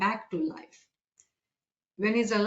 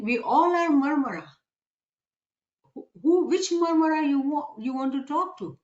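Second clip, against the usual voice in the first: raised, louder voice for a crowd of fish; higher-pitched, lightly acted questioning; fishes answering; 5 semitones higher, 3 dB louder than usual. Thousands of fishes answering the man at the river, lightly voiced chorus.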